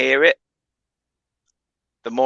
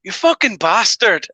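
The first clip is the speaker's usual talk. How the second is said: drawn-out, higher-pitched Scottish outburst; angry Scottish accent; 7 semitones higher, slower than usual. Retold or imitated. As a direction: mimic him slipping into angry Scottish. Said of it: "And then next minute, it's like".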